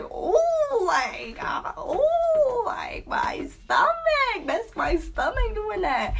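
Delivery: high-pitched, drawn-out moaning about cramps; cramp agony. Acted. Storyteller reenacting her labor cramps, high exaggerated wail. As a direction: act out painful cramps, high and slow. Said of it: "messing me up was the cramps".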